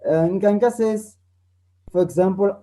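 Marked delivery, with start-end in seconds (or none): none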